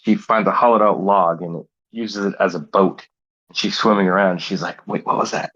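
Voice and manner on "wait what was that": whisper. Acted. Wife spots something in flood.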